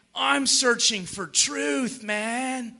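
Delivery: slow, drawn-out laid-back seeker act; mocking slow impression of a skeptic. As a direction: drag it out like a hammed-up truth seeker. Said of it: "many times skeptics and".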